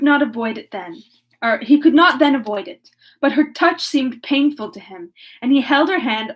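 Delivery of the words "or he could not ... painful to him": ordinary delivery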